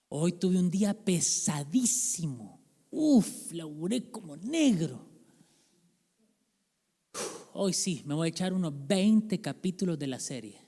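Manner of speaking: slow, dragging tired voice with a big sigh; hammed-up exhaustion; mock worn-out complaint. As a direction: play someone wiped out, justifying the couch. Tired person excusing binge-watching.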